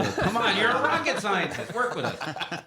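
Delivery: high, loud laughing disbelief; laughing exasperation. Real, not acted. Mocking the rocket scientist.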